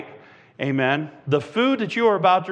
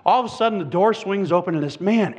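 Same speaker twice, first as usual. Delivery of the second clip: usual speaking voice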